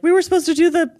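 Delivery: high, loud panicked voice; panicked retelling. Retold or imitated. Telling the doctor, mock panicked and high. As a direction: squeak it out in a rush of panic. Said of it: "we're like".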